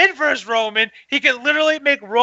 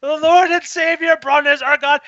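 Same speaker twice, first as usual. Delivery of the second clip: mock worship; 7 semitones higher than usual. Fan hailing Braun as a god, mock sermon. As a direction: hail him like a holy savior. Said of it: "And I said".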